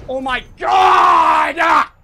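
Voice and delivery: very loud, drawn-out yell; real exasperated howl; frustrated scream. Real, not acted. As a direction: scream it out, climbing to a peak.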